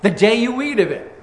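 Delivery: loud, slow, commanding decree; emphatic. Acted. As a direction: proclaim it as God's firm warning. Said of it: "God said emphatically".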